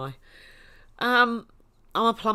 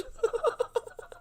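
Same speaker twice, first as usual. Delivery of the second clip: airy, helpless wheezing laugh; breathless high laughter. Real, not acted. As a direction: laugh until no sound comes out. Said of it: "I'm crying".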